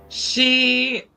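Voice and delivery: ordinary talk, nothing marked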